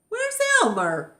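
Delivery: ask, baffled and high; puzzled asking. Acted. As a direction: elephants asking for Elmer. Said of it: "they asked".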